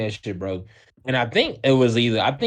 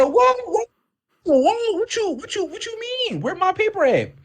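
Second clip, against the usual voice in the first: squeaky high-pitched shocked "what"; shocked disbelief. Retold or imitated. Student to teacher, squealing in shocked disbelief. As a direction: blurt it out in total disbelief. Shocked outburst at detention news.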